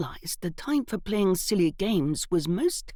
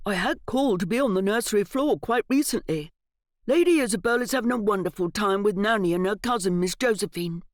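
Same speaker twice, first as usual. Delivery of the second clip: happy butler; 3 semitones higher, 4 dB louder than usual. A narrator's reading. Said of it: "his voice lifting in pure happiness".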